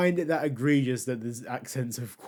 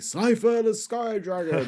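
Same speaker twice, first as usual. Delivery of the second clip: higher, slow, dramatic dub announcer call; Dan Green impression; 8 semitones higher, 3 dB louder, slower than usual. Fan imitating the English dub's card summon, dramatic. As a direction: boom out the monster's name like a cartoon duelist. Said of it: "it's not gonna be Dan Green saying".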